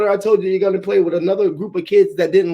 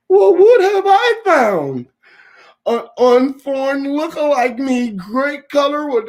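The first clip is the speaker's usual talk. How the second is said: put-on very high, slow, louder child voice; 7 semitones higher, 3 dB louder, slower than usual. Kid marveling at new Florida neighbors, high and slow. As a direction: play a wide-eyed little kid.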